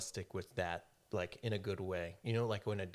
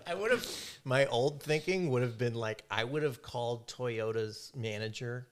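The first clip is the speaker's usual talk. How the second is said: ordinary talk, nothing marked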